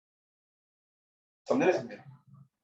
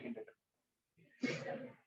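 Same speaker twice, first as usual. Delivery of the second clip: whispered hint; 18 dB quieter, much slower than usual. Shown as a demonstration. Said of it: "whispered answer hint".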